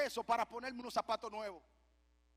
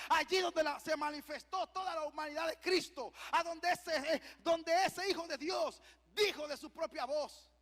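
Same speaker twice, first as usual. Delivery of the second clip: raised, higher preacher's cry; fervent preaching; 4 semitones higher, 4 dB louder than usual. Acted. Preacher describing Christ in Gethsemane, high and fervent. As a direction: build up to the sacred quote with force.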